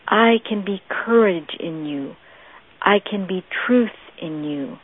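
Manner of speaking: intimate Jesus voice. Acted. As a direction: draw them in gently, dropping almost to a whisper. Preacher voices Jesus speaking to congregation.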